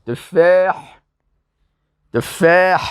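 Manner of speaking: تفاح with a stretched vowel and strongly breathed final ح; emphatic, separated تفاح with audible ح; careful model pronunciation. Shown as a demonstration. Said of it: "درست تلفظ کنید".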